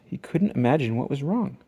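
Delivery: plain reading voice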